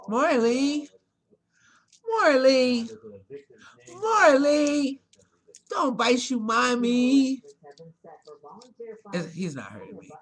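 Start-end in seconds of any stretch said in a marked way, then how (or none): 0.1-7.4 loud, higher calls of the name; scolding a biting pet loudly. Real, not acted. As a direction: call him off sharply.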